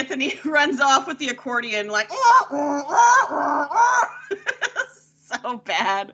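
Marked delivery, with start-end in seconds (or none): 2.2-4.8 comic repeated wail, pitched high; imitated running-off noise. Imitating actor fleeing with the accordion, mock wailing.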